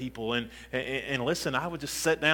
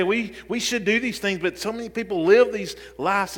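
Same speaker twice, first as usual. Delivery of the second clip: higher, forceful sermon voice; pressing, raised-pitch exhortation; impassioned preaching; 5 semitones higher, 5 dB louder than usual. Real, not acted. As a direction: challenge the congregation with urgent questions.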